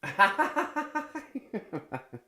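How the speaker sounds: bursts out in a loud high laugh; a big real laugh, high and loud. Real, not acted.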